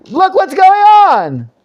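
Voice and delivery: very high, loud, drawn-out mocking cry; Hosea's sarcasm. Teacher as the prophet jeering at Israel, sarcastic. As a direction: stretch it out in a high, loud jeer. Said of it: "Hosea here isn't serious when he's saying".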